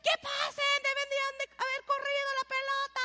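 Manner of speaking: high-pitched frustrated yell at the TV; yelling at the coach. Retold or imitated. Fan yelling at the coach on TV, frustrated high shout. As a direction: shout at the screen like an angry fan. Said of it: "le gritan a la televisión".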